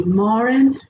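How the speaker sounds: Marin drawn out with an open "ah", MAR-in; first pronunciation. Shown as a demonstration. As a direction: offer Marin slowly as "MAH-rin". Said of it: "Tell me".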